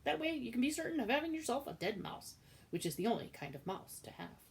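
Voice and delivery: high, loud lecturing cook; bossy cook. Acted. Cook explaining her rule about mice to Midge, loud and bossy. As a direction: lay down the law loudly.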